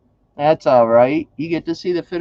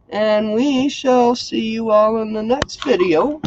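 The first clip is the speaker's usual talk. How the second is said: normal talking voice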